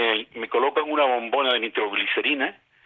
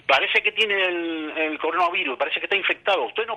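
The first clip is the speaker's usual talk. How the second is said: much higher, urgent voice of a nurse; alarmed hospital staff; 9 semitones higher than usual. Retold or imitated. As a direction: play the nurse, high-pitched and urgent, slowed on the warning. Staff announcing infection.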